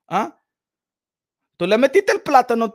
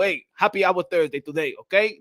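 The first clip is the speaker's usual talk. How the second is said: normal talking voice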